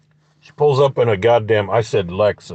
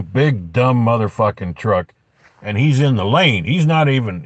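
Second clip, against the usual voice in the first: ordinary talk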